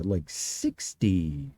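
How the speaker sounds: deep, drawn-out low note; low-pitch demonstration. Shown as a demonstration. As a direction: drop deep and stretch it out. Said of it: "that's closer to".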